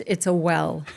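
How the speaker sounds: "well" said with a short eh vowel, standard English. Shown as a demonstration. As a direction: say "well" cleanly, not "whale". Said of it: "For those of you who speak English".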